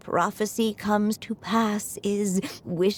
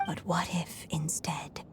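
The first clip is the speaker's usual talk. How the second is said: trailing off into a much softer whisper; fading whisper; 11 dB quieter than usual. Acted. Old Anya confiding to Alice, voice fading to whisper. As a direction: let the breath run out on fate.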